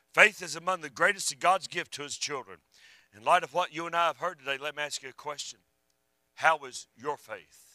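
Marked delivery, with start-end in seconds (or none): none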